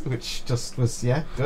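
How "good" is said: ordinary talk, nothing marked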